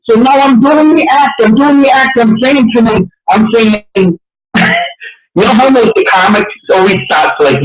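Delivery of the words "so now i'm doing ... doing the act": normal talking voice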